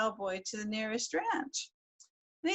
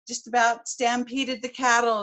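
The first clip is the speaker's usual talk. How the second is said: plain reading voice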